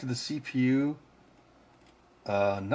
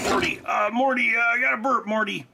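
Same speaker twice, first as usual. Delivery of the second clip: cartoon scientist voice; 9 semitones higher than usual. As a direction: slur it like a burping cartoon grandpa. Cartoon quote about a burp.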